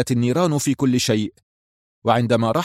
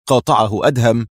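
ordinary delivery, nothing marked

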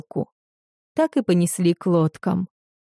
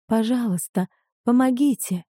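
pleading; 4 semitones higher than usual. Acted. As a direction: beg them gently for help. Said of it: "взмолилась незнакомка".

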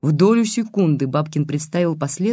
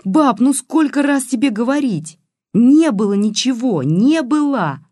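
put-on high, loud girlish exasperation; desperate protest; 8 semitones higher, 5 dB louder than usual. A narrator's reading. Girl denying it to her granny, desperate protest. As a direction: insist desperately, pitch way up. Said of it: "с отчаянием в голосе выкрикнула".

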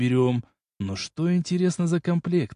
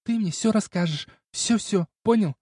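put-on serious young woman; 8 semitones higher than usual. Girl demands the whole truth.

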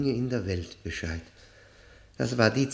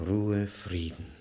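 usual speaking voice

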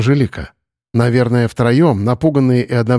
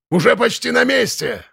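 high yell over the storm; shouting over wind; 10 semitones higher than usual. A narrator's reading. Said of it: "стараясь перекричать ветер". (крик сквозь ветер)

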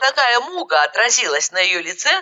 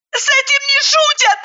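very high angry cry; shout; 3 dB louder than usual. A narrator's reading. Zhanna shouting at her friend and throwing a pillow, very high. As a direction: fling it out high in outrage. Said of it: "крикнула Жанна".

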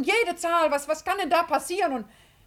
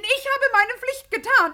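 usual speaking voice

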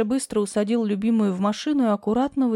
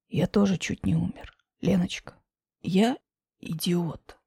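lower, softer man's voice; low male voice; 4 semitones lower, 3 dB quieter than usual. Acted. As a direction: confess it low and soft. Grisha low.

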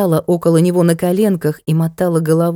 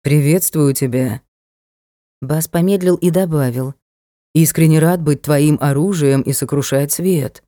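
low vibrating voice; 4 semitones lower than usual. A narrator's reading. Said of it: "очень низкий вибрирующий голос".

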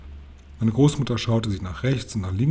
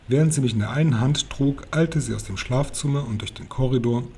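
ordinary delivery

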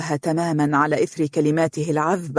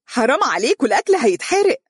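high-pitched exclamation of alarm; high, urgent wail over burning food; crying out; 8 semitones higher, faster than usual. A narrator's reading. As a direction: cry out in protest, pitched way up. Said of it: "وهي تصيح".

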